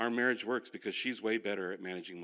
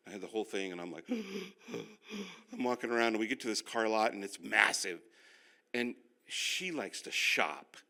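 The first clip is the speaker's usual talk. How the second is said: ordinary delivery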